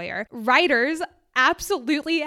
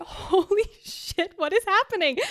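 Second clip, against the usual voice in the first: laughing disbelief; 9 semitones higher than usual. Real, not acted. Host reacting to chart success, stunned and high. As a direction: let the disbelief spill out.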